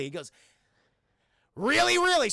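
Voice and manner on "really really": high, loud acted disbelief; exaggerated astonished shout; mock amazement. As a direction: squeal it like a thrilled naive recruit. Acts out hero's amazed response.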